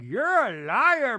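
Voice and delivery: high, loud, drawn-out accusation; slow, hammed-up mock shout; Meredith. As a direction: wail the accusation theatrically. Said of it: "And Meredith's like".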